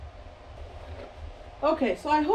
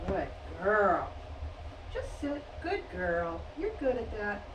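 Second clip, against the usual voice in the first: soft praise; 5 semitones lower, 8 dB quieter than usual. Real, not acted. Quiet praise to dog close by.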